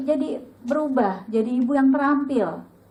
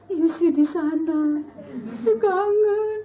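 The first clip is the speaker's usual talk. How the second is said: tearful; 3 semitones higher, much slower than usual. Retold or imitated. Her own tearful reply retold.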